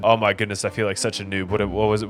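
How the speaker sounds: normal talking voice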